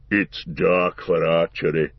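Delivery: low, heavy dwarf voice; gruff gravelly dwarf voice. Acted. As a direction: growl it out like a stout dwarf. Gimli the dwarf speaks.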